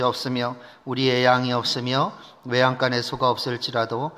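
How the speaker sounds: even, monotone congregational reading; scripture reading. Real, not acted. Preacher leads the congregation in Habakkuk, measured scripture reading. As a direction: recite the verses steadily on one level pitch.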